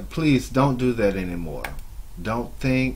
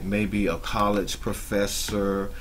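usual speaking voice

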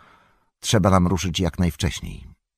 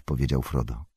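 ordinary delivery

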